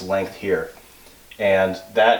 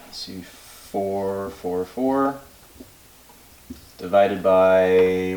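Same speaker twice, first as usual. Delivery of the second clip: very slow, drawn-out working out; slow thinking aloud; much slower than usual. Real, not acted. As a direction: stretch it out while calculating.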